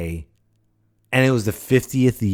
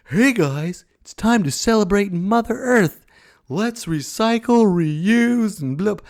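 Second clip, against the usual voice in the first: high, loud, peppy announcer pitch; hammed-up fake enthusiasm; mock cheerleading; 10 semitones higher, 5 dB louder than usual. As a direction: sing out a tired yearly reminder, mockingly. Mocking the yearly Earth Day announcement.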